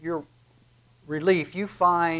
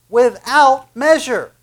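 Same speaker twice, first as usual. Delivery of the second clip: louder and slower, pitched higher, every syllable stretched; emphatic repeat; 7 semitones higher, 10 dB louder, slower than usual. Acted. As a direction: hammer home "Without measure" slowly.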